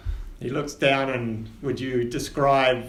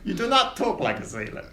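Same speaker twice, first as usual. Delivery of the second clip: higher, pompous captain voice; captain Winters; 5 semitones higher than usual. Acted. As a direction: quiz the new passenger grandly. GM as captain questions Nibbles.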